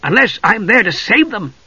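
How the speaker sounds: high, bright cry; eager exclamation. A narrator's reading. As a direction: jump in with glee. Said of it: "he had an idea".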